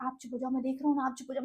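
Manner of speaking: calm her down quietly; soft hushing quote. Retold or imitated. Guard hushes her. (Guard की धीमी, शांत करती आवाज़)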